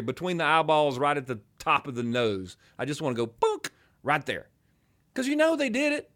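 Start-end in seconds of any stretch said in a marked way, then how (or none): 3.3-3.7 mock thump sound, pitched way up; a sharp high comic thump noise; boonk. Mimics thumping someone's head.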